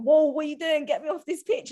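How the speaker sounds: high startled protest, mock panic; mock alarm. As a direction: yelp it like a friend is dragging you on the pitch. Panicked shout at teammate.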